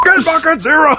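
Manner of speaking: high hammed-up shout; imitated angry yell; mock yell. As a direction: shout it like a crazed bowler. Echoing the shouted quote.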